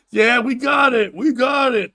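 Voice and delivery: acted shout across the water; calling back. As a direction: holler it back like an excited boat crowd. Onlookers calling back from the boat.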